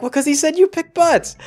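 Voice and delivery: high-pitched protest; hammed-up high retort; mock indignant Mia. Mia argues back in retelling.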